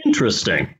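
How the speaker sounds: normal talking voice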